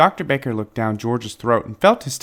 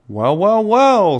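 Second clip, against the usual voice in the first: rising, louder well well well; the doctor spots it, pleased; pleased surprise; 9 semitones higher, 6 dB louder, much slower than usual. Acted. Doctor finding the puzzle piece on the x-ray, surprised and louder.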